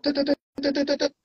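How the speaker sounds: high, rapid "tut-tut-tut" mimicry; imitated ticking. Trader watching the price climb past his target, high imitated ticking.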